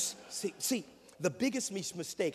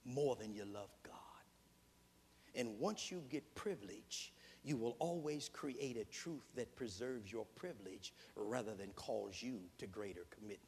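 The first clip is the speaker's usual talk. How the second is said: soft, low, fading whisper; hushed whisper; 6 semitones lower, 10 dB quieter than usual. Real, not acted. Preacher hushing the congregation on a warning. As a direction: let it drop to a confiding hush. Sinking to a hush.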